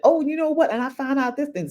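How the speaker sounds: a light mock of people excited over a find; imitating eager discoverers. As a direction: play up the thrill of a new discovery.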